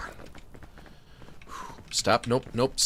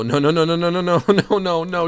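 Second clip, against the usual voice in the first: rapid rising alarmed no's; real panic with a laugh; panicked nos; 4 semitones higher, 5 dB louder than usual. Real, not acted. Slipping off the cliff.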